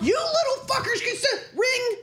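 high-pitched scolding mom, imitated; mock angry mom. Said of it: "It's my mom answering the phone".

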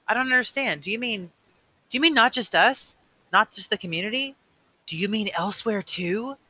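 put-on a boy struggling to grasp something huge; young Jonas, bewildered. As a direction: Jonas asking the old man, puzzled and pressing.

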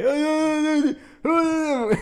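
put-on very high, loud drunken "ooho"; loud drunk whoop in a theater. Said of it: "[name]'s talking super loud".

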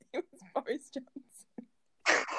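whispered, slow put-on voice; breathy whispered impression of a friend.

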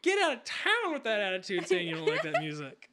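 loud, laughing mock outrage; fake-scold her friend through laughter. Scolding a friend who doubts the music, loud laughing mock outrage.

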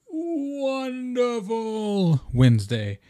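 sing-song high. Pretend. Mock gloom about Wednesday.

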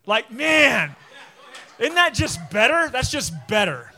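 loud, laughing delight; delighted outburst. Real, not acted. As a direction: exclaim it loudly through a laugh. Delighted by own accent demo.

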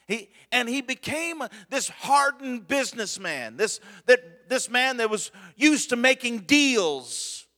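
high, loud preacher's build; preaching at full force. Acted. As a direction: preach it out, rising hard.

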